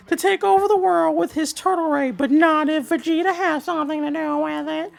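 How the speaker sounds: put-on high, breathless kid voice; high childish reading voice; eleven-year-old voice. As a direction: read it like an excited little kid. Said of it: "in my best eleven-year-old voice".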